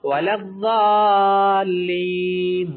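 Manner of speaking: الضالین drawn out very slowly and loud, ض like d; wrong recitation demo. Shown as a demonstration. The teacher imitates a mistaken reciter. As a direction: loudly stretch الضالین with a d sound. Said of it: "بعض لوگ زال کے ساتھ پڑھتے".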